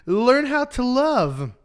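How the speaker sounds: God answering. Acted. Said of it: "God's like".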